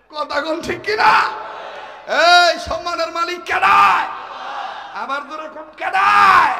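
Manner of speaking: high shouted call to the crowd. Real, not acted. Waz preacher demanding the crowd answer, shouting high. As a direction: rouse them to shout back.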